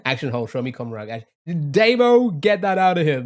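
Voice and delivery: ordinary delivery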